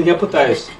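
usual speaking voice

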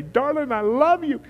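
high, tender, sing-song love; a husband telling his wife he loves her, sweetly; loving greeting. Retold or imitated. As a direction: coo it to your wife. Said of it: "before I brush my teeth and say".

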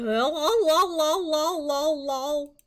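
loud, looping babble; mock-dazed, loud singsong babble; scatterbrained self-mockery. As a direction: babble it mindlessly, over and over. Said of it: "you guys know how I am at the beginning".

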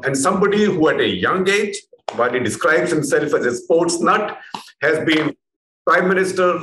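ordinary delivery

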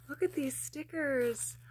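slow breathy hush over the stickers; awed whisper. Real, not acted. To viewers, marvelling at stickers from a friend: slow awed whisper. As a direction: hush it out in delight, slowly.

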